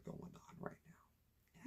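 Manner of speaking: slow, breathless whisper of a scared thought; teen hiding from the tornado, whispering to himself. Retold or imitated. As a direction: whisper it like a secret fear. Inner thought during the tornado.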